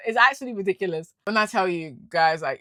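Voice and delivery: ordinary delivery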